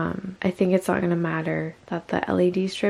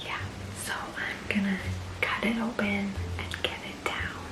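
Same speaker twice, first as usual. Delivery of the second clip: hushed whisper so no one hears; 7 dB quieter than usual. Real, not acted. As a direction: whisper quietly, someone is home. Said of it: "I have to whisper a little bit because Joel is home".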